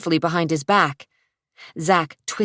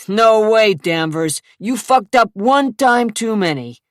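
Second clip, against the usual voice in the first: loud, threatening thug voice; menacing thug; 5 semitones higher, 6 dB louder than usual. Acted. Tall thug threatens Zach, loud menacing voice. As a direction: lean in and threaten.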